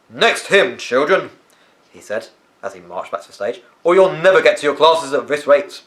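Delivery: put-on stern headmaster raising his voice over the children; booming headmaster. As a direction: call the hall to order like a strict headmaster. Headmaster calling children to order.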